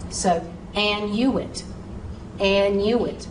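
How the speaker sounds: and you it, with a stressed separate you; syllable by syllable. Shown as a demonstration. Teacher drills annuit by its syllables. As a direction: break it into an, you, it. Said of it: "say the U, say the I".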